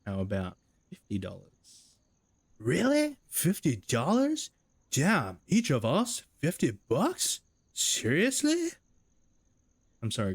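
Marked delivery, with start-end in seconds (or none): 0.0-2.0 smug. Acted. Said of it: "Responds Doug with a smug little lip curl". 2.6-8.7 very high, slow, loud astonished squeals; shocked disbelief. Acted. Stunned reaction to big offer.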